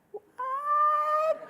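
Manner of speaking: a very high, squeaky what; dog hearing a whistle. Retold or imitated. Reacting to the bandwidth news like a startled dog, squeaky. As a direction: squeak it like a dog catching a sound only dogs hear. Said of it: "dog that hears the sound".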